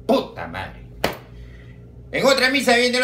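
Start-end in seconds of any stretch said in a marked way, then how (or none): 0.1-0.9 deep muttered puta madre; low grumbled curse. Acted. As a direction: growl it under your breath. Same muttered reaction after punchline.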